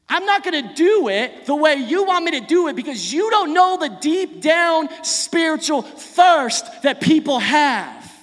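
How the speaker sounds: high, loud preacher's voicing of a firm refusal; emphatic refusal. Jesus refusing his brothers' human-engineered way, emphatic and loud. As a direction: refuse forcefully, leaning on the key words.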